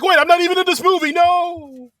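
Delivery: high, fast panicked protest; panicked yelp. Retold or imitated. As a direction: squeal it out in a panic. Bautista attacked by mob.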